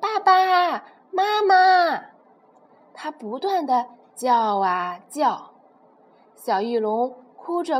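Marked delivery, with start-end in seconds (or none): 0.0-2.0 high, slow, drawn-out call; little pterosaur shouting for his parents; loud cry. Acted. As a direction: call out for mom and dad with all your might. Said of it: "小翼龙大声地喊道".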